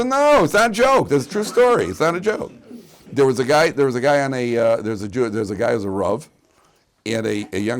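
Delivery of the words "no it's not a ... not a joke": higher, louder protest that it's true; insistent. Real, not acted. Rabbi insisting to laughing audience his story is true, insistent. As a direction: insist over the laughter, pitch jumping up.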